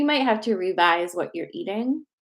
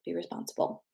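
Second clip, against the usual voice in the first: whispered aside; 10 dB quieter than usual. Real, not acted. Whispered aside after medical disclaimer.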